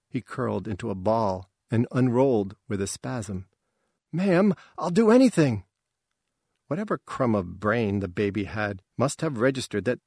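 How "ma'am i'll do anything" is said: high, loud begging; urgent high-pitched pleading; desperate plea. A narrator's reading. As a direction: beg with the voice pitched right up.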